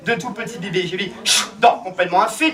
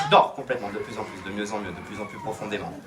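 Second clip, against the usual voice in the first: very low, hushed monotone; hypnotic sleep induction; 12 semitones lower, 6 dB quieter than usual. Acted. Hypnotist sends volunteer back to sleep, low hypnotic murmur. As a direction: lull them under in a deep, soft drone.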